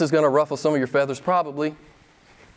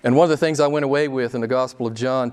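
normal talking voice

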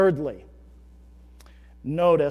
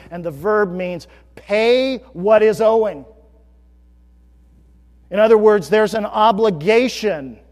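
louder preaching on the key verse; forceful preaching; 4 semitones higher, 12 dB louder than usual. Acted. Pastor preaching the key verse to his congregation, louder. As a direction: drive the verse home to the pews.

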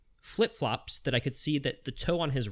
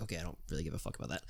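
fast, much softer under-the-breath retort; muttered aside; 8 semitones lower, 12 dB quieter, much faster than usual. Retold or imitated. Storyteller recalling his private reply to the woman, fast soft mutter. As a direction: rush it out quietly, dismissive.

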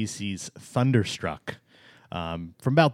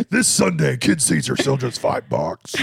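loud booming announcer voice; monster truck ad; 3 semitones higher, 4 dB louder than usual. Pretend. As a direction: sell it like a radio ad. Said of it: "You know the monster truck commercials?".

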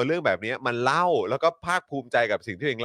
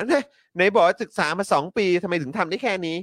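mock criticism; 7 semitones higher than usual. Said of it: "มีคนบอกว่า".

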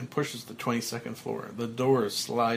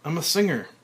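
elated singer; 5 semitones higher, 5 dB louder, faster than usual. A narrator's reading. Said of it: "He hits a high note".

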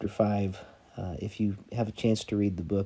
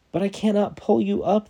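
high, louder desperate cry; man at the railing crying out he can't; 12 semitones higher, 7 dB louder than usual. Acted. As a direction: cry it out helplessly. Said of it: "the man cried".

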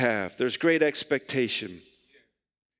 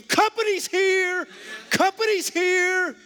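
high, drawn-out mock scream; imitated scream; 3 dB louder, slower than usual. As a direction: holler it like a trash-talking ballplayer after a basket. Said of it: "I'd scream".

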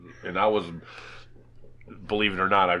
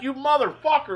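higher loud disbelieving cry; mock outrage; 4 dB louder than usual. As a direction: shout it on realizing the joke. Realizing the prank caller.

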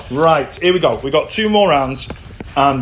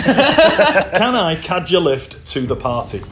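drawn-out, careful model phrase; slow repeat; slower than usual. Shown as a demonstration. Host repeats the phrase for a puzzled contestant, slowly. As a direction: say it again slowly for the learner. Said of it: "can you say it again?".

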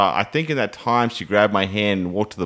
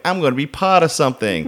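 higher, lightly girlish retold voice; 6 semitones higher than usual. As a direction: imitate her little girlish delight, pitch lifted. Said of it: "little girlish, like, giggle and smile".

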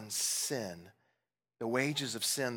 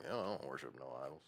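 low, quick, offhand mock denial; mock dismissive churchgoer; 9 semitones lower, 10 dB quieter, faster than usual. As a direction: toss it off softly as everyone's denial. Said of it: "'cause we're all like".

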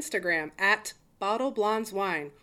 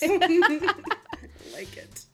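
laughing; 9 semitones higher, 6 dB louder, much slower than usual. Real, not acted.

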